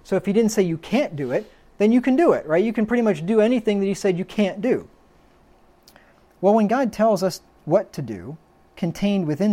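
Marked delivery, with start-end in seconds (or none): 0.0-4.9 mock objector. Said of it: "And they're gonna say".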